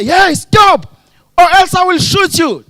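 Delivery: high, loud mock threat; acted gunman's shout; shouted warning. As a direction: yell it at someone running away.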